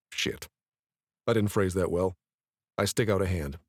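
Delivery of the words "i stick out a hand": ordinary delivery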